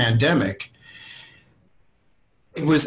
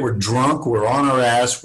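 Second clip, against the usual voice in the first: ordinary talk, nothing marked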